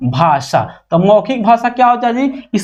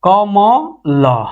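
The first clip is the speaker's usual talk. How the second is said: कमल stretched out very slowly; drawn out; much slower than usual. Shown as a demonstration. Teacher showing students the word कमल, very slowly. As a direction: draw out कमल, syllable by syllable. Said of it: "चाहे और लिख दें".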